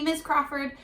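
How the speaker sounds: ordinary talk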